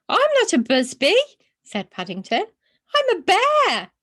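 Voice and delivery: high, loud indignant bear voice. Acted. Bear corrects the boy's mother, high and indignant. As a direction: put them straight, offended.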